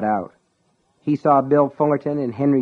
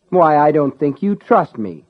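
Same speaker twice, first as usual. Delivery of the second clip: teasing, playful mock surprise, louder and higher; jocular, teasing; 4 semitones higher, 6 dB louder than usual. A narrator's reading. Ace needles the sheriff who wants his license, jocular teasing. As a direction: tease with a jocular lilt. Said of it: "He spoke in the same jocular, teasing".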